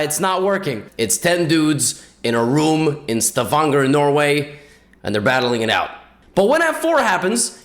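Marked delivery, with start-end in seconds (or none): none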